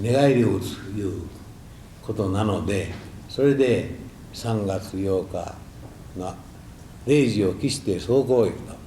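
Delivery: ordinary delivery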